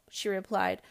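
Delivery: lively storybook read-aloud voice; story narration. A narrator's reading. Host reading a picture book to children, animated.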